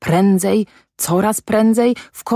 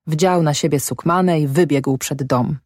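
ordinary delivery

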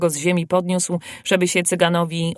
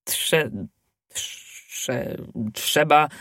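low, broken stammer; frightened babbling; 3 semitones lower, 3 dB quieter, much slower than usual. A narrator's reading. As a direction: stammer as if shivering with fever. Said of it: "bełkotał koszałek, dygocąc jak w febrze". (trwożliwy bełkot)

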